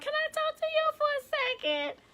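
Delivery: very high, softened timid voice; nervous. Retold or imitated. Choir member asking the director for a word, nervously. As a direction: imitate a nervous, tiny-voiced request. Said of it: "you know, she was nervous".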